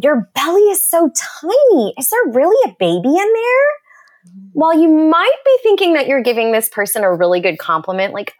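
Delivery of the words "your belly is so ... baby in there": high-pitched gushing disbelief; exaggerated cooing astonishment; mock amazement. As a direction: imitate a tactless admirer. Acts tactless remark to pregnant woman.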